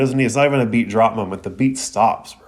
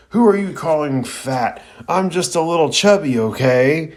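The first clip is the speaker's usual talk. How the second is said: higher and louder, indignant bellow; furious roar; 6 semitones higher, 3 dB louder than usual. A narrator's reading. Choji whirls on Zaku, furious roar. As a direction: roar it back, deeply offended. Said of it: "roars furiously".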